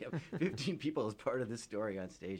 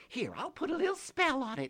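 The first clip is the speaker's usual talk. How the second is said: tiny pixie voice; 6 dB louder than usual. Acted. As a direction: squeak like a tiny tearful fairy. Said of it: "and she said".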